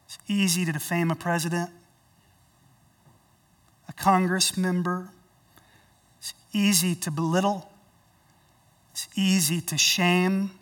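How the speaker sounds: ordinary delivery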